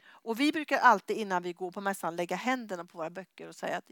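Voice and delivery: usual speaking voice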